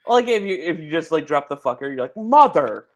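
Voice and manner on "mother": loud, high-pitched mock curse; mock swearing exclamation. Shown as a demonstration. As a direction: exclaim it like a substitute swear word.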